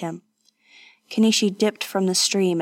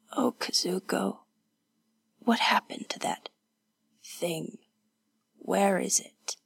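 soft, barely voiced and halting; weak, fearful whisper; 7 dB quieter than usual. Wounded woman asking about the demon, weak whisper. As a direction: whisper it with gaps, as if badly hurt.

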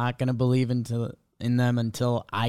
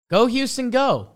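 cheering; 11 semitones higher, 4 dB louder than usual. Real, not acted. Cheering for the Astros.

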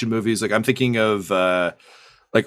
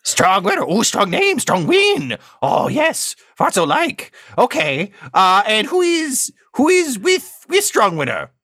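tiny, much higher creature voice asking for the password; squeaky little doorman demanding the password; 10 semitones higher, 3 dB louder, slower than usual. Acted. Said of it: "a little voice says".

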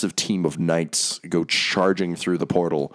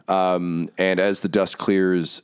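usual speaking voice

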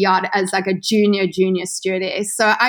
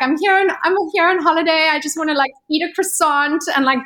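high, fast, tearful pleading with a laugh breaking through; hammed-up tears to get past customs; mock crying; 7 semitones higher than usual. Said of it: "I was just crying".

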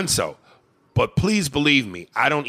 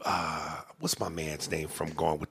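whisper; 6 semitones lower, 9 dB quieter, slower than usual. Real, not acted. Whispering aside to co-host.

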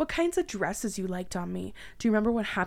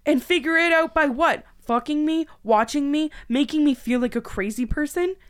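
very loud, high, outraged yells; screaming in outrage; 7 semitones higher, 8 dB louder than usual. Acted. Woman screaming at ex who spied on her, outraged. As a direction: scream it right at him. Furious at ex.

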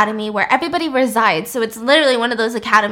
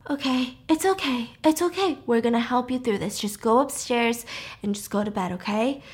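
gentle, hushed comfort for someone upset; soothing reassurance; 5 dB quieter than usual. Retold or imitated. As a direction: softly talk someone down. Couple soothing the upset Lizzie.

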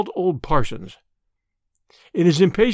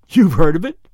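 louder, startled question; sudden interest; 9 dB louder than usual. Acted. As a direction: snap to attention, surprised. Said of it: "with a sort of sudden interest".

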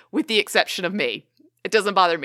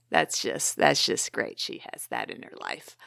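whispered, much lower bitter aside; sarcastic whisper; 8 dB quieter than usual. Retold or imitated. Listener mutters privately, a lower sarcastic whisper. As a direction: breathe the resentment under your breath.

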